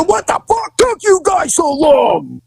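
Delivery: high, slow, angry shout; high irritated shout at backup; irritated yell. Retold or imitated. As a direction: yell at them for taking so long.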